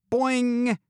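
high, sustained cartoon boing; long high-pitched "boing"; boing sound effect. A narrator's reading. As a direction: sing out a springy boing. Narrator voices a cartoon sound effect.

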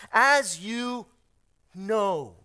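AS YOU punched loud, then a pause before KNOW; emphatic verse reading. Real, not acted. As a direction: hammer "as you", hold back, then land "know". Stressing repeated words in verse.

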